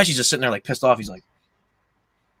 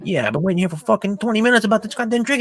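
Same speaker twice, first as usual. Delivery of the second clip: fast, high-pitched retold rant; mock impatient tirade, pitched up; imitating angry complaint; 9 semitones higher, faster than usual. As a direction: rattle off an irritated customer's gripe. Acting out Tommy's angry complaint.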